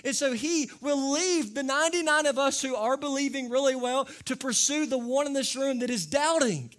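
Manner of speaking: loud, high, pleading preacher's cry; preaching at full force. Real, not acted. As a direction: reach out to the doubter. Preacher's climax to congregation.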